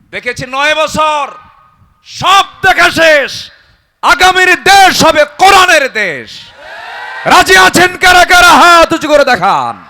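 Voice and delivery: full-force sermon shout; rallying shout. Acted. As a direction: rouse the crowd to raise hands. Preacher rallying the crowd.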